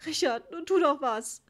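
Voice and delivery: distressed, tearful-sounding appeal; close to tears. A narrator's reading. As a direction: plead helplessly as if about to cry. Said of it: "als ob sie gleich weinen wollte".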